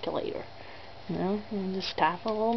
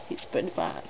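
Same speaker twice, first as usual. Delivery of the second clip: low murmur; 6 dB quieter than usual. Shown as a demonstration.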